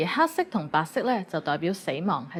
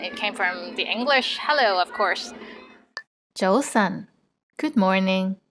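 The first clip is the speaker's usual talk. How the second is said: ordinary delivery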